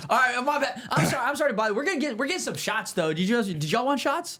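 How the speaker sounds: high, hammed-up sheepish apology; mock apologetic bro. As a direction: overdo the sorry-to-bother act. Fake apology, offering shots.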